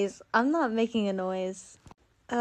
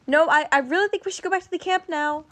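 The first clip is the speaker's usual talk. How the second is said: higher and fast, pleading to leave; anxious insistence; 6 semitones higher, faster than usual. Acted. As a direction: rush it out like something is coming. Insisting on returning in fear.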